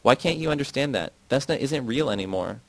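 ordinary delivery, nothing marked

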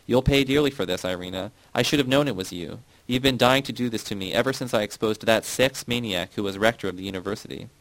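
plain reading voice

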